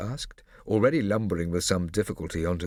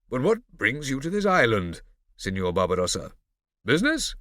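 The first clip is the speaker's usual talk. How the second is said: condescending; 5 semitones higher, 6 dB louder than usual. Acted. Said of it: "condescending tone".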